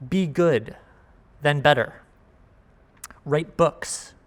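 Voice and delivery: usual speaking voice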